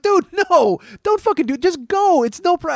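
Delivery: high, loud, comic exasperation; acted frantic outburst, pitch way up; exasperated urging. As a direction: burst out at someone to stop dithering.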